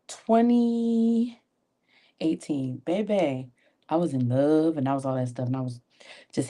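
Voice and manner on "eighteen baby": usual speaking voice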